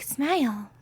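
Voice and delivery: hammed-up leering men; mock catcaller. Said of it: "they can be like".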